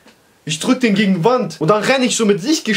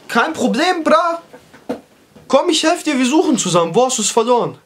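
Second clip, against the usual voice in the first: pitched way up, keen to help; high eager offer; 6 semitones higher than usual. Retold or imitated. Telling his upset barber not to worry, high and eager. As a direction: chirp it out like a helpful buddy.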